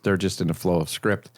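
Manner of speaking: ordinary talk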